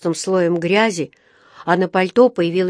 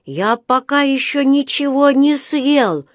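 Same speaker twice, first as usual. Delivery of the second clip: slow, higher-pitched surprise; удивлённо; 6 semitones higher, 4 dB louder, slower than usual. A narrator's reading. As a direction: answer as a puzzled little bear. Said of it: "удивлённо сказал Паддингтон". (удивлённо, медленно и повыше)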